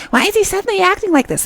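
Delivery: high, quick mock suspicion; suspicious. Seol wondering in her head, imitated as suspicious. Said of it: "so critical and so suspicious".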